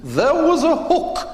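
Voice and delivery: very high, slow, loud grande dame voice; lofty, drawn-out theatrical dame; Edith Evans punchline. Retold or imitated. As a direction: deliver it like a lofty old actress, amused.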